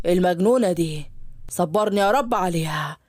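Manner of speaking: low, weary fatherly sigh of a line; the father shaking his head; exasperated father. Acted. Father mutters after daughter leaves.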